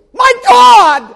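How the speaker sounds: high, held scream; anguished cry. Acted. Jesus crying while angels draw swords, anguished scream. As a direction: cry to the Father. Preacher voicing crucifixion cry.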